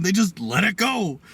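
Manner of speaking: normal talking voice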